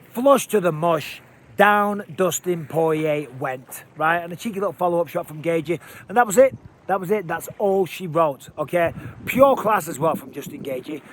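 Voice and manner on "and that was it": higher and louder, punching out the finish; thrilled recap of the finish; excited call. Real, not acted. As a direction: call the knockout like it just happened.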